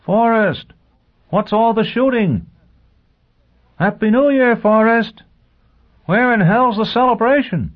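high, loud shouted calls from below; rowdy high-pitched crowd yelling; calling out. A narrator's reading. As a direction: holler up to someone in the house. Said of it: "Voices below began to call".

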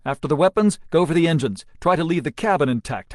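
loud, high battle orders; shouting orders over battle. A narrator's reading. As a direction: direct the gunner over the noise. Captain directs fire.

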